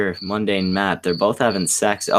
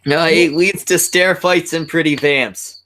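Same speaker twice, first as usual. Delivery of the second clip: mock warning; 8 semitones higher, 4 dB louder than usual. Real, not acted. Teasing bit about dolphin mead.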